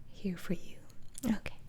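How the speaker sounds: normal talking voice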